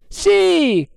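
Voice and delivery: very high, loud, held cry; shouted reply. A narrator's reading. Lookout confirming the sighting, shouting. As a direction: call it down from aloft. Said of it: "rispose il gabbiere".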